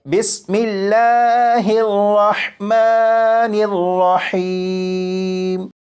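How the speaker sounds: slow, drawn-out tajweed recitation; Quranic recitation. Shown as a demonstration. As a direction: recite it slowly with long drawn vowels. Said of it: "इस हर्फ को तीन मरतबा मैं पढ़ूंगा". (धीमी, खिंची हुई तिलावत)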